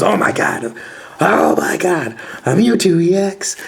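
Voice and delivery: high, thrilled disbelief at a great pull; excited. Retold or imitated. Friend thinks he pulled a Mewtwo, high excited squeal. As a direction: squeal it like you can't believe your luck. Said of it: "He was losing his mind".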